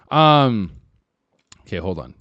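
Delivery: normal talking voice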